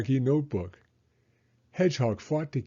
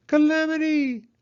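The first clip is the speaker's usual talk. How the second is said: high, loud screech; an owl screeching from far away; Owl's screech; 6 dB louder than usual. A narrator's reading. Owl screeching in alarm, high and loud. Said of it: "a familiar screech".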